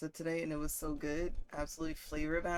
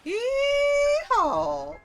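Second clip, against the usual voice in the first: loud, high whooping cowboy holler; full-throated yeehaw shout; yeehaw cheer; 10 dB louder than usual. Real, not acted. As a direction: let out a big rodeo yeehaw. Cheering about Southern food.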